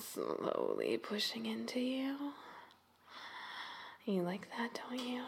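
hushed, teasing whisper; seductive whisper. Acted. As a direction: whisper it into her ear.

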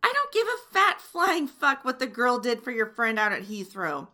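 loud, furious telling-off that keeps building; furious rant. Acted. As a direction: let the outrage boil over at him. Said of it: "You've made me so mad".

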